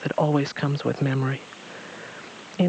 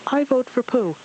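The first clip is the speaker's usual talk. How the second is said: a higher, eager shout; 10 semitones higher than usual. A narrator's reading. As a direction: Piglet casting his vote with a shout. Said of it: "Piglet shouted".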